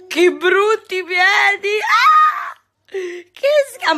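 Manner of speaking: high, loud, slow 'yuck'; hammed-up disgust for a laugh; high loud mock disgust. Real, not acted. Playful disgust at toddler's feet.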